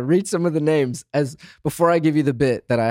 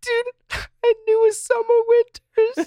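laughing. Real, not acted. Laughing surprise at name.

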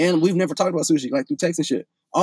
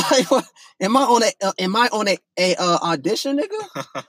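laughing disbelief; 4 semitones higher than usual. Retold or imitated. Retelling shock at meeting friends.

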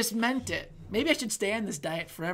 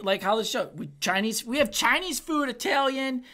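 ordinary talk, nothing marked

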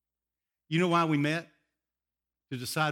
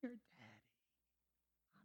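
hushed voice of the Lord; 6 semitones higher, 22 dB quieter, much slower than usual. Retold or imitated. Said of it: "And the Lord says".

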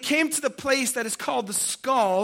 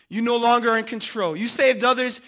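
quick, forceful, louder accusation; loud taunt; 5 dB louder than usual. Acted. Mockers accusing Jesus on the cross, loud taunt. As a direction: hurl the charge at him loudly.

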